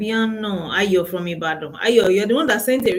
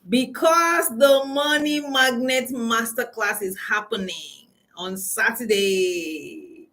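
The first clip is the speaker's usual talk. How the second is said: slow, stretched-out title; slow announcement; 2 semitones higher, slower than usual. Real, not acted. Host announcing her masterclass to viewers, slow and stretched. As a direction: spell out the masterclass name slowly.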